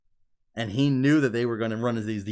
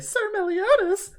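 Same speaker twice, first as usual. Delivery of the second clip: put-on very high, louder girly cry; anime girl squeal; 6 dB louder than usual. Fan showing what she says whenever he touches her, mock squeal. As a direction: squeal it high and drawn out like a flustered anime heroine. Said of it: "every time he touches her or anything".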